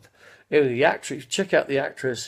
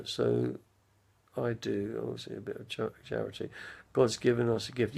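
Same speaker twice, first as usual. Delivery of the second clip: normal talking voice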